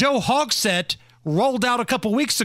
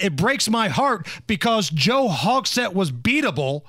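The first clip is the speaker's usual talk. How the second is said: ordinary talk, nothing marked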